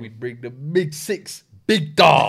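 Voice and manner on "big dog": high, loud hype call; big it up; hype shout. Real, not acted. Hyping the guest.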